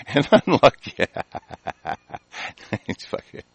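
cracking up mid-quote; words dissolving into wheezy, fading laughter; real laughing. Real, not acted. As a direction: laugh helplessly through the words. Cracks up at article's word unlucky.

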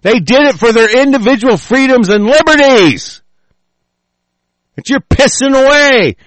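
high-pitched, heated yell; angry berating. Real, not acted. Speaker berates listeners over freedoms given up, angry yelling.

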